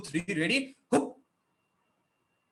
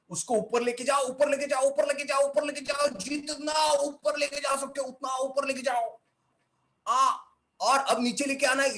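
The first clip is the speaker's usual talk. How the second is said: high, sustained chant urging upward; rising exercise coaching; 7 semitones higher, much faster than usual. Shown as a demonstration. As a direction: push the voice high and keep repeating. Coaching eyebrows upward, voice climbs.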